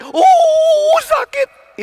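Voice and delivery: high, loud yelp of pain from a burned finger; pained cry. Acted. Preacher acting a burned finger, crying out in pain. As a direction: cry out as if fingers touch fire.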